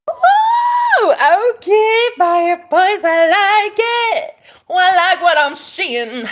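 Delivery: high, loud flirty cheering; excited hype. Real, not acted. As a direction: cheer the commenter on, delighted. Reacting to a viewer comment.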